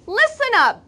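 high, loud call for attention; bossy call. Acted. Boy calling out to big shots, bossy and high. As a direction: command the room.

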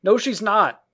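put-on high, loud childish protest; loud denial. A narrator's reading. Peter denies Wendy is married, loud high protest. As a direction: refuse it with a shout. Boy refuses to believe.

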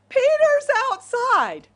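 high, loud, drawn-out excited cry; overjoyed announcement. Acted. Servant girl interrupts the prayer meeting, overjoyed. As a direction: burst in with thrilling news. Acts overjoyed Rhoda running back.

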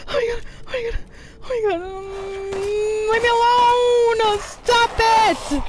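high, loud crying screams; terrified screaming. Real, not acted. Gamer screaming as the enemy attacks again, terrified. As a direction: shriek in real fear.

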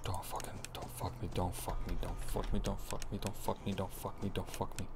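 breathless terrified whisper, barely voiced; hushed begging whisper in real fear; frightened whisper. Real, not acted. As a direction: plead with the game under your breath. Terrified player begging the game.

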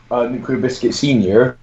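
normal talking voice

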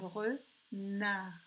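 "renard" as re, pause, long nard; split into syllables. Shown as a demonstration. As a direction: hold the n starting the second syllable of "renard". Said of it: "dans la deuxième syllabe".

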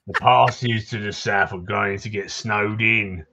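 put-on townsman in a Cockney accent, gruff and chatty; grumbling Cockney local greeting a stranger; Cockney NPC. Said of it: "you sound fucking Aussie".

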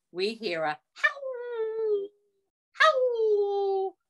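high, drawn-out howl; howled word. Acted. Wolf howl in story.

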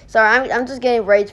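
ordinary delivery

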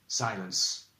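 usual speaking voice

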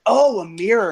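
high, loud "Oh" of realization; sudden realization. Retold or imitated. Clerk finally understanding the Scottish customer's mirror, surprised. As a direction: act the sudden penny-drop. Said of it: "And I was like".

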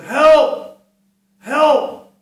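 high, loud cries of help; cry for help. Retold or imitated. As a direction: yell it out like someone trapped. Voice crying out from chimney.

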